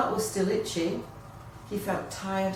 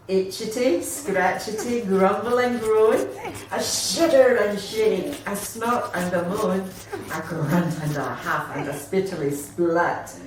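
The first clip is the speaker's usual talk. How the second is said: hammed-up climax; 6 dB louder than usual. A narrator's reading. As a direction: build it up with relish. Final scratch, dramatic rhyme.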